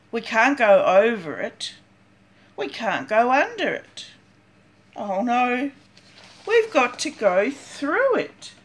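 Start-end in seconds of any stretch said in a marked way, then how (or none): none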